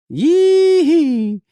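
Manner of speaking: high, loud mock yell; rowdy yee. Summing up the storm chasers' yelling as a mock yell. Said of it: "I go".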